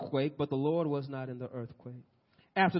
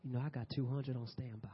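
soft, lowered aside as God; hushed, understated reply; mock divine whisper; 3 semitones lower, 10 dB quieter than usual. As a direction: murmur it back casually, voice dropped. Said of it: "God whispers back to him and says".

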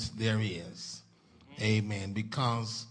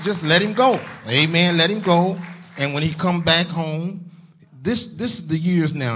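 loud, high-pitched rising exhortation; preaching; 5 semitones higher, 8 dB louder than usual. Real, not acted. Pastor urging Zion to let their preacher go, preaching. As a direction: build to a forceful call to the church.

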